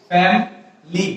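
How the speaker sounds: family in two syllables, FAM-lee; American contracted pronunciation. Shown as a demonstration. Teacher giving the correct form, family as fam-lee. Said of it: "What is the correct pronunciation".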